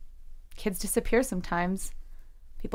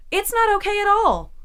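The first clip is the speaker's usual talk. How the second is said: high, loud cry of frustration; angry outburst; 13 semitones higher, 6 dB louder than usual. Acted. Jesse lashing out at her therapist, loud. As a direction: let it burst out of her.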